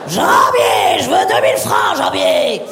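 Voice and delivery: put-on deep, gruff shouted old-movie voice; Jean Gabin impression. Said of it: "je pouvais imiter Jean Gabin".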